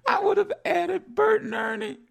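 very high, squeaky through laughter; laughing. Real, not acted. Host still cracked up over Bert and Ernie, laughing. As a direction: barely get the joke out while cracking up.

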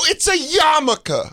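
put-on high, slow kid voice; imitating himself as a kid. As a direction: spell it out slowly like a boy. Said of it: "I go".

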